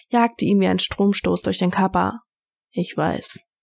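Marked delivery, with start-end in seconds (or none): 2.7-3.5 rough voice. A narrator's reading. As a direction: lower and softer, rough-voiced. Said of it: "sagte Derek mit rauer Stimme".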